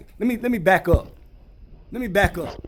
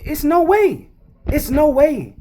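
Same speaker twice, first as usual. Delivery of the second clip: high, louder flat denial; indignant disbelief; 10 semitones higher, 5 dB louder than usual. Real, not acted. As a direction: refuse to believe it, pitched up. Ranting about scale.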